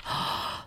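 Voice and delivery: sharp unvoiced gasp in shock; a retold shocked gasp; imitated gasp. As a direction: suck in air like a startled old man. Hotel owner reacts to broken glass.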